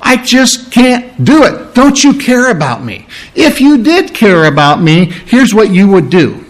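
put-on pleading grievance; complaining plea. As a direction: plead as if feeling uncared for. Preacher voices a complaint to God.

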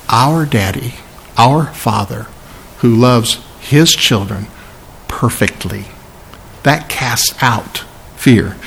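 low, soft, slow reverence; quiet and tender, pausing between phrases. Real, not acted. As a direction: drop to a hushed, unhurried reassurance. Preacher tenderly describes the loving Father.

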